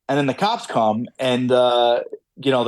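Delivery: normal talking voice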